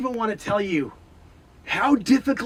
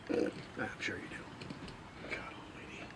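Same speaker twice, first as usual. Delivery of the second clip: whispered aside; 13 semitones lower, 20 dB quieter than usual. Acted. Dreading the scene, muttered aside.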